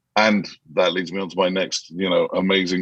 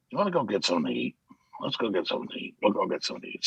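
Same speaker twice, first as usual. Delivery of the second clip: hushed invitation; 8 dB quieter, faster than usual. Retold or imitated. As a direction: murmur it like a quiet aside. Banks quietly suggests food.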